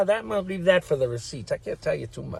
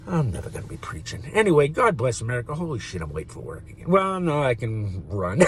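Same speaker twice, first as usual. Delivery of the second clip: normal talking voice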